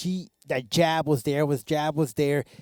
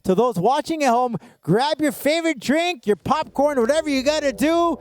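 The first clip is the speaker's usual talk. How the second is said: builds to a higher, shouted hype; announcer hype; 7 semitones higher than usual. Acted. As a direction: pump up the crowd, rising to a shout.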